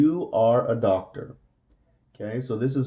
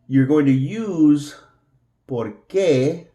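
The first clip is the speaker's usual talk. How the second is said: going stressed, higher and louder; emphatic teacher stress; 3 semitones higher, 5 dB louder, slower than usual. Shown as a demonstration. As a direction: lean hard on going to use. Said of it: "this is important".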